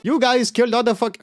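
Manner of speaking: hammy Indian accent imitation; lightly put-on Indian accent. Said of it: "Can I do an Indian accent?".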